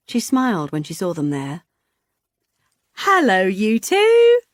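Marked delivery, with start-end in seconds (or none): 3.0-4.4 high, slow, loud hello; booming greeting. A narrator's reading. Vicky greeting the couple, booming. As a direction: boom it out across the garden. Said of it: "she boomed".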